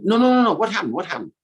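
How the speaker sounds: high, insistent begging; eager friends demanding the secret; pleading gopis. Acted.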